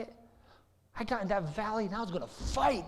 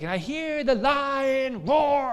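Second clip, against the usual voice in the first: loud, higher, slow fervent singing-speech; belting it out; 7 semitones higher, 6 dB louder, slower than usual. Retold or imitated. Wife singing worship in the car, loud and fervent. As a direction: put your whole heart into the roar. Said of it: "She's like".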